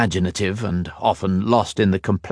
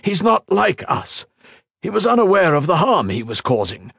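much higher, louder insistence; heated defence; 10 semitones higher, 3 dB louder than usual. A narrator's reading. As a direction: defend him fiercely. Tamar defending.

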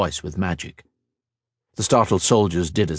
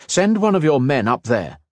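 firm command, pitched higher; ordering; 6 semitones higher, 3 dB louder than usual. A narrator's reading. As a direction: give the order like a commander in charge. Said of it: "he ordered".

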